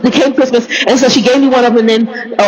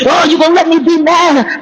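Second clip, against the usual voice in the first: mock indignation; 5 semitones higher than usual. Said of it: "get mad at him and say".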